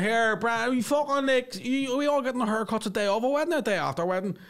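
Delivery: mock a rowdy Belfast passenger, pitched high; hammed-up Belfast spide; spidey Belfast lad. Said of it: "two of the most spideyest Belfast people".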